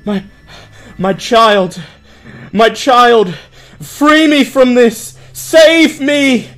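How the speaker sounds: very high, loud pleading cry; shrill wailing plea; desperate begging NPC. Acted. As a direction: beg your child to save you. Pinned NPC begging his savior.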